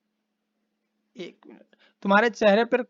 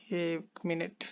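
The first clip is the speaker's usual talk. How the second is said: whispered aside; 2 semitones lower, 11 dB quieter, slower than usual. Real, not acted. Aside while fixing the screen.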